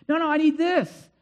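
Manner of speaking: high, loud acted refusal; angry protest. Believer arguing with God over what is taken, high angry protest. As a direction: plead and insist, clinging to it. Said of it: "we get angry".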